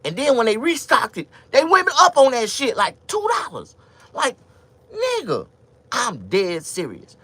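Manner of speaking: loud, high indignant complaint; outraged rant. Real, not acted. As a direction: rant about the price jump. Outraged about price hike.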